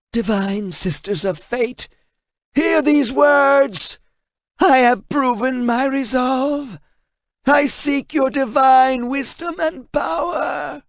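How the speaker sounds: high, slow, shouted incantation; forced invocation. Acted. Translator reading the runes, shouted invocation. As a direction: cry the ritual words aloud.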